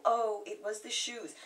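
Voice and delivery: usual speaking voice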